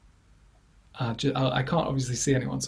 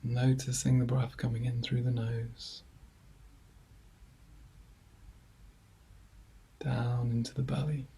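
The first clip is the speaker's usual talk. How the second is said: guided meditation; 5 dB quieter than usual. Acted. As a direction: ease listeners gently into calm, leaving long silences between phrases. Leads a body scan.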